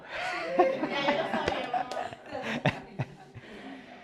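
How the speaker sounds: a bright, high burst of laughter. Real, not acted. Preacher laughing at his own reveal, high laugh.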